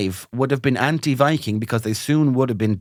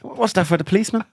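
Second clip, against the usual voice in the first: granny's confusion; 8 semitones higher, faster than usual. Retold or imitated. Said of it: "She's like".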